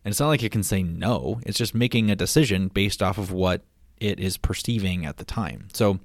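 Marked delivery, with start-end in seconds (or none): none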